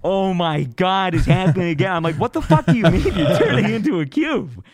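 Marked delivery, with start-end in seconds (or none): 0.0-1.9 imitating a friend's panic, high and stretched out; mock panic. Retold friend panics as trip returns. 2.2-4.6 raised, louder, baffled outburst; incredulous. Retold or imitated. Retold baffled reply to friend.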